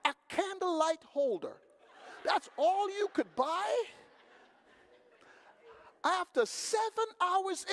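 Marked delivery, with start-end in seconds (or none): 2.2-3.8 incredulous. Retold or imitated. Disbelief at one candle holder.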